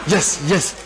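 low mock mumbo of rote praying; repetitive empty prayer. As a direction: imitate someone with nothing to pray. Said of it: "Then you repeat prayer".

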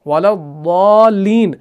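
"वलद्दालीन" very slow, lam joined to dad; slow melodic recitation; Quranic recitation. Shown as a demonstration. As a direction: draw out "वलद्दालीन" slowly. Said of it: "लाम को हम दौद के साथ मिलाते हैं".

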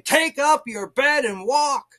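high, loud and slow command; commanding proclamation. Retold or imitated. Preacher voicing Jesus's command to get up, loud and commanding. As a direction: proclaim it with force.

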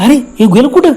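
high startled cry of surprise; surprised shout. Retold or imitated. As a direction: call out in sudden astonishment. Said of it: "आश्चर्याने ओरडलं".

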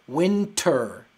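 Winter said loud with a hard T. Shown as a demonstration. Teacher stressing the strong T in Winter. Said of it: "la T es dura".

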